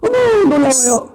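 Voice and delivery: high-pitched, hammed-up wonder; mock feminine squeal of surprise; gushing amazement. Shown as a demonstration. Preacher showing charming women's reactions, high amazed voice. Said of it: "막 놀라워요".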